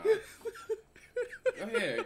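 slow high teasing go ahead; mock drawn-out urging. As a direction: stretch it out teasingly. Mocking the man in clip.

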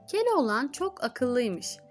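plain reading voice

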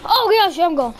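high, loud burst of alarm; startled outburst. Real, not acted. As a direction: yelp it out as the game goes wrong. Sudden shock during game.

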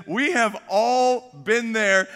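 slow, emphatic imitated sympathy; amused officer. Officer reassuring driver who locked wife out, emphatic sympathy. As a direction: stretch it out in amused fellow-feeling. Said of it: "he just starts cracking up".

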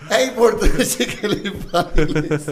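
loud, slow words through laughter; laughing quote. Retold or imitated. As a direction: repeat it while cracking up.

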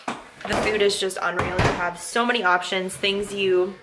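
ordinary delivery

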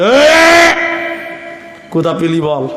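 put-on glare and snap at the boy; a hammed-up stern demand; mock glare. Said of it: "চোখ গরম করে বলছে".

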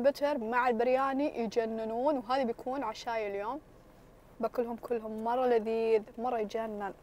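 chewing while talking; speaking through face veil; mouth full. Real, not acted. Seen 0: Lifts forkfuls toward veiled mouth; chewing inferred, mouth itself hidden.

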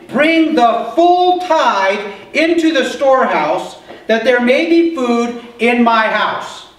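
slow solemn reading of scripture. Acted. Preacher reading scripture to the congregation, slow and solemn. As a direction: read each word out slowly and solemnly.

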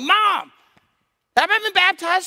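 loud, high-pitched mock yell for mom; calling out. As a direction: burst through the door hollering like a panicked kid. Boy bursts in calling his mother.

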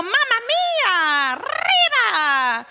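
laughing mock foreign exclamation; mock Italian. Shown as a demonstration. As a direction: ham up a silly Italian-Spanish voice, laughing. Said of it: "or, or".

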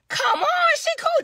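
very high mock-cheerful sarcasm; high sarcastic squeal. Real, not acted. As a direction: squeak it out in disbelief. Sarcasm about Jay hanging up.

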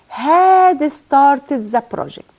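very slow and clear, had pushed a little; slow dictation. Shown as a demonstration. Teacher dictating the converted sentence to the class. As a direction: dictate it slowly so students can write. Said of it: "انا هعمل ايه".

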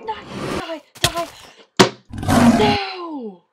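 terrified gamer screaming at a monster; panicked screaming. Real, not acted. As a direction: shriek in real panic as it attacks. Panicked fight with a mob.

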